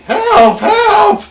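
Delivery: high, loud drawn-out scream; screaming for help. A narrator's reading. Story reader voices people lying on the ground, screaming for help. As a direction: scream out for rescue. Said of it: "people lying on the ground screaming".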